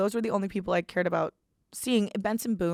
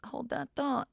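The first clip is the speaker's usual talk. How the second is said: hold that thought; 6 semitones higher, 4 dB quieter than usual. Retold or imitated. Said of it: "And I was like".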